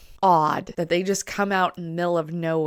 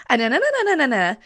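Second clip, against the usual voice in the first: high, louder "na" syllables; 8 semitones higher, 6 dB louder, faster than usual. Shown as a demonstration. Showing high talkers, a high bright na. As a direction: pitch the na up high. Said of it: "people who talk up here".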